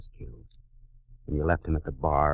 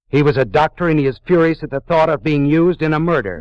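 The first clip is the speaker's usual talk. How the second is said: raised voice; 10 semitones higher, 7 dB louder than usual. Acted. Detective to suspect, raising his voice in accusation. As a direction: voice rising high and loud, furious. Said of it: "You're raising your voice".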